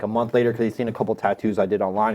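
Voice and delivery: normal talking voice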